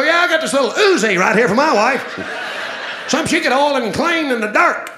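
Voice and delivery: loud, high comic brag; boastful one-upping. Acted. Husband outdoing the gun owner's brag, loud boastful.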